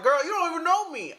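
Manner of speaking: high, squeaky imitation of a sassy woman; sassy girl voice. Imitating a viewer brushing her off, in a high squeaky voice. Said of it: "she be like".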